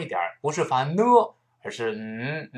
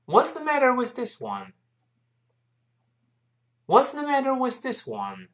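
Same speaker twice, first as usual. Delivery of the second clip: quicker, higher-pitched model reading; brisk high start gliding down; a bit faster; 10 semitones higher than usual. Shown as a demonstration. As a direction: speed the question up, falling at the end. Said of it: "好，我们快一点儿".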